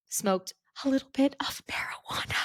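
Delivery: breathy whisper of the secret; whispered confession. Retold or imitated. Whispering the secret to the nurse. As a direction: drop to a whisper on the guilty part.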